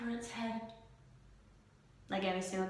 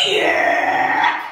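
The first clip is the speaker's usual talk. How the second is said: loud triumphant yell; shout; 16 dB louder than usual. Real, not acted. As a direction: cheer at full volume. Celebrating own joke.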